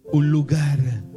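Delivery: usual speaking voice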